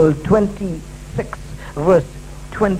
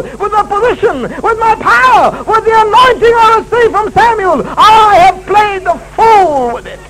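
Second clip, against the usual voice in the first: anguished confession; 12 semitones higher, 5 dB louder, slower than usual. Acted. King of Israel's confession.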